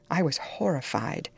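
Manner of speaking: ordinary delivery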